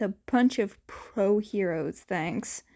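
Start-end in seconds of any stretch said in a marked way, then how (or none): none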